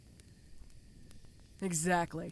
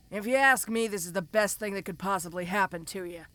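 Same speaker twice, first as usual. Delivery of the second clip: normal talking voice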